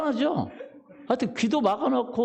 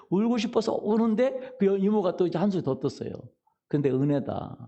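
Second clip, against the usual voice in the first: usual speaking voice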